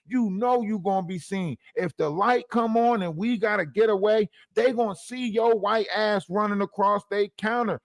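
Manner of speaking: high-pitched comic complaint, piling on the reasons; hammed-up indignant telling-off; exasperated scolding. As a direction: scold a liability of a teammate, pitched well up. Acts roaches scolding the albino roach.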